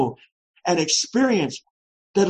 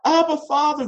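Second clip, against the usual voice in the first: crying out Abba; 9 semitones higher than usual. Retold or imitated. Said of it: "We cry".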